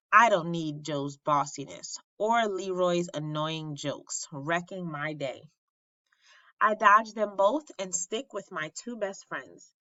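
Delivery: ordinary delivery, nothing marked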